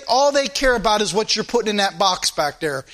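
ordinary talk

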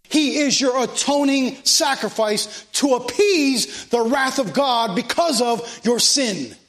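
impassioned preaching. Real, not acted. Preacher pressing the congregation on Christ's sacrifice, impassioned preaching. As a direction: preach it with urgency, pitched well above normal talk.

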